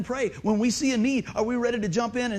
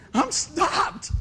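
retold defence; 10 semitones higher, 3 dB louder than usual. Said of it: "I was like".

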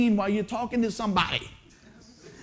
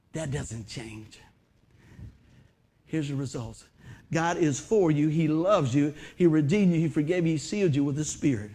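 tender assurance; 6 semitones lower, 4 dB quieter than usual. Acted. Softly repeats the assurances.

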